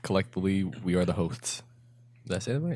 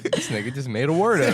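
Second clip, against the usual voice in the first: higher, louder, laughing mock disbelief; laughing tease; 6 semitones higher, 7 dB louder than usual. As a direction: tease a friend through laughter. Teasing friend over a made-up word.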